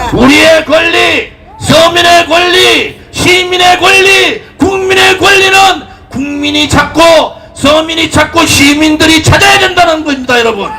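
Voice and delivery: impassioned political rally speech; roaring rallying speech. Acted. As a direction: rouse the crowd at full force.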